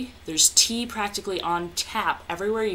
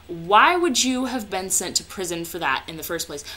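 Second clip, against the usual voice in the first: usual speaking voice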